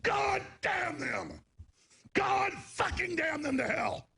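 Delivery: very slow, high repeated shout; Charlton Heston curse. Retold or imitated. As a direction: hammer each curse out slowly. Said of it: "He's saying".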